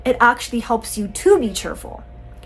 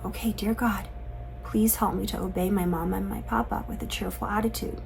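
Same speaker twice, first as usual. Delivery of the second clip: put-on modelled child's prayer; 2 semitones lower, 10 dB quieter than usual. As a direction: pray quietly, voice well down. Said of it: "spend some time praying with them".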